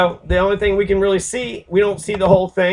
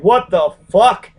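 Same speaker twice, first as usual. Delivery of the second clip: slow, loud, higher cry of disbelief; imitated stunned disbelief; 4 semitones higher, 7 dB louder, slower than usual. The torn-up friend reacting to the shooting, slow loud disbelief. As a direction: drag out the shock as the friend. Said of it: "Was like".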